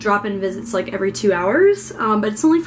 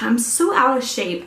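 normal talking voice

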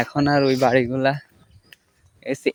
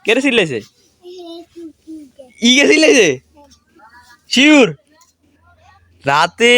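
angry scolding; 10 semitones higher, 3 dB louder, slower than usual. Real, not acted. Woken by shouting kids, scolds them loudly. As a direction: tell them off for the racket.

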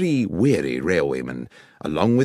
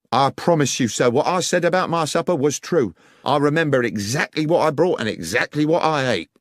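higher, insistent working man; earnest guard pleading his case, pitched up; protesting railway guard; 8 semitones higher, 4 dB louder than usual. Acted. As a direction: protest your honesty to a superior. Voices the guard protesting honesty.

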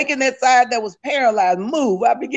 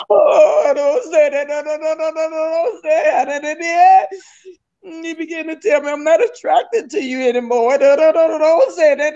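high, crying, repeated pleading no; sobbing plea; 5 semitones higher than usual. Real, not acted. Wife crying out against her husband's words, sobbing and pleading. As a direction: beg through sobs, voice cracking high.

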